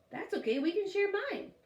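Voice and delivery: lightly voiced eager boy; bright kid's voice, lightly done; child voice. A narrator's reading. Child character voice in story.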